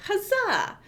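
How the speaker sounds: high, bright triumphant cheer; cheery huzzah. Real, not acted. Streamer cheering as YouTube goes live, high triumphant huzzah. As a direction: celebrate the stream going live.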